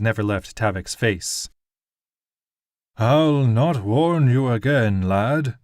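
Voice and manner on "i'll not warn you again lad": slow, louder sneering lord. A narrator's reading. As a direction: sneer the warning slowly. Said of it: "the nobleman sneered".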